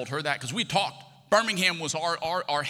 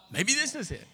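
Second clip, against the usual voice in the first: hopeful thought; 9 semitones higher than usual. Retold or imitated.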